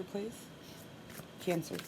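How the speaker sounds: ordinary talk, nothing marked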